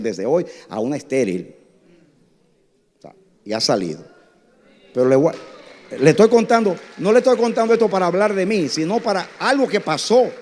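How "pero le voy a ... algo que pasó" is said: high, loud insistent preaching voice; rising to full preaching force, protesting; emphatic preaching. Real, not acted. Preacher insists to the congregation, loud and high. Preacher stressing he is not boasting.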